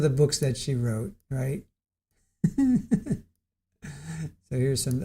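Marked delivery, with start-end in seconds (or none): 2.4-3.2 high breathy giggle; a real giggle at the silly titles. Real, not acted. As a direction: let a giggle escape. Laughing at book titles.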